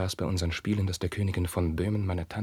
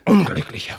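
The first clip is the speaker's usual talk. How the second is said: much higher and louder, recoiling; pained cry; 11 semitones higher, 9 dB louder than usual. Acted. Carlos recoiling from Roderich's question, pained cry. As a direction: cry out as if struck.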